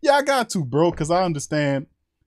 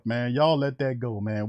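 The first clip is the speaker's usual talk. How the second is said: normal talking voice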